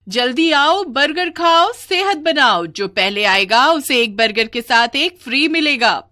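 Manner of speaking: loud, high rhythmic sales cry; street vendor's hawking. Acted. Witch hawks burgers from her cart, high loud calls. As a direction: call out brightly pitched slogans to passers-by. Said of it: "burger बेचने लगती है".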